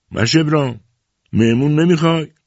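high, lifted hail; calling out. A narrator's reading. Visitor knocks at Mash Ebram's door, calling out. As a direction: call through a door to a neighbour.

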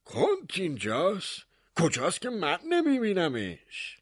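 high, puzzled mayor's question; surprised. Acted. Mayor asking the baker where the count is, surprised. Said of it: "با تعجب پرسید".

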